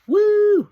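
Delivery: high, loud fan whoop; excited whoop. Real, not acted. Fan cheering the Bucks' return, loud whoop. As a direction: let out a big cheer. Cheering for Super Kick Party.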